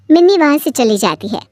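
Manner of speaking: ordinary delivery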